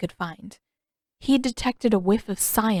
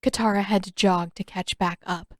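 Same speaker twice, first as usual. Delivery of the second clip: ordinary delivery, nothing marked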